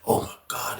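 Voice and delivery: whispered. Retold or imitated. Onlookers shocked.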